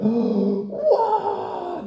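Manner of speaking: high, loud wow; amazed angel cry. Acted. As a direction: gasp out a stunned wow. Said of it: "And they went".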